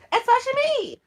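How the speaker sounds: high, loud self-praising squeal; diva squeal. Real, not acted. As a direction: shriek it out like a proud diva.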